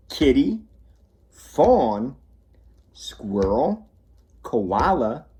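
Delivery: gentle bedtime storytelling; reading to children. A narrator's reading. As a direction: read it like a bedtime book.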